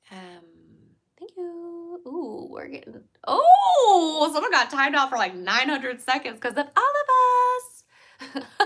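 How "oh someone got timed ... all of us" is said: long high "oh", then loud and drawn out; loud excited outburst. Real, not acted. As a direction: crow it loudly at the whole chat. Reacts to someone getting timed out.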